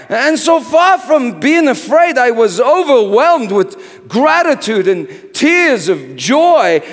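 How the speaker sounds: preaching at full force. Acted. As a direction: thunder out the joy of the fire. Sermon climax on fire, love.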